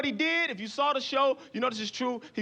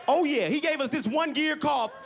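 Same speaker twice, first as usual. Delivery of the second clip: ordinary talk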